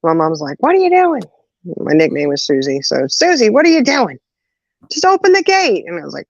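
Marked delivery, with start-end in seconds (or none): 0.6-1.2 high-pitched imitation of an impatient mom; mom demanding. Mom calls out to child. 3.1-5.9 imitating mom calling out, pitched high; mom demanding. Mom calls out to child.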